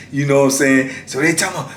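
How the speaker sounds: ordinary talk, nothing marked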